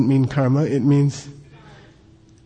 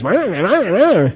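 high, swooping nonsense nagging; singsong high-pitched mock ranting; mock yammering complaint; 5 semitones higher than usual. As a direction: imitate someone griping with wordless wah-wahs. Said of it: "Somebody comes up to you and says".

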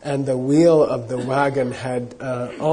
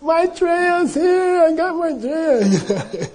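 joyful calling out; 14 semitones higher, much slower than usual. Retold or imitated. Running through town shouting news.

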